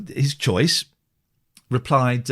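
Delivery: ordinary delivery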